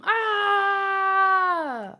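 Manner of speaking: panicked scream. Acted. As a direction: let out a long scared cry. Character cries out in manga.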